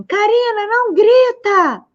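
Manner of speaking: mother yelling at her daughter not to yell; demonstrative shout. Acted. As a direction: shout the scolding to show the contradiction. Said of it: "E eu tiver gritando".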